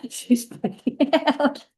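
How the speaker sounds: laughing high and slow through the words; cracking up while telling it; real laughter breaking the words up. Real, not acted. Laughing retelling of client's panic.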